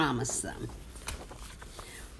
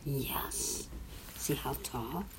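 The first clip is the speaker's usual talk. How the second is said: whisper; 4 semitones lower, 14 dB quieter, slower than usual. Real, not acted. Parent pointing at picture with child.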